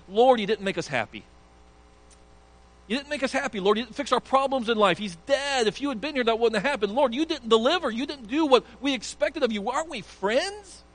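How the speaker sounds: high, loud accusing voice of a hurt friend; complaining Mary. Acted. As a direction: scold Jesus for not showing up, voice pitched high. Preacher voices Mary complaining to Jesus.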